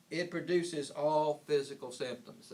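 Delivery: "all" given the weight, a little slow; stress on all. Shown as a demonstration. Teacher underlining "all" for students. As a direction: lean on "all" so it lands. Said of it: "emphasizing the word all".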